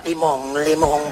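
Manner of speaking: low-pitched mock moans of passion; hammed-up low male voice; guy's moan. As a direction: answer low like the excited guy. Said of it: "Y el pata".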